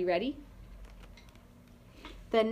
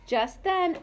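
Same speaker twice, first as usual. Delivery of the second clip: ordinary delivery, nothing marked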